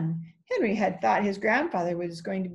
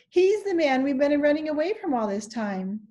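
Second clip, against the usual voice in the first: eager, higher-pitched announcement; 7 semitones higher, 4 dB louder than usual. A narrator's reading. Henry explains to Jesse who the man is, eagerly. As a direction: blurt out the big news eagerly. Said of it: "said Henry eagerly".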